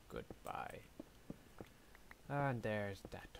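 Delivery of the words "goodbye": whisper. Pretend. Final farewell whispered.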